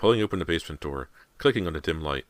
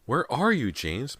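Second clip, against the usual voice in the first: high, louder frightened call; frightened cry; 9 semitones higher, 3 dB louder, slower than usual. A narrator's reading. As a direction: cry out the question in fear. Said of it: "Missus Fraser cried".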